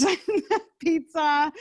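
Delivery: laughing through the word. Real, not acted. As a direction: chuckle as you say it. Laughs listing kid foods.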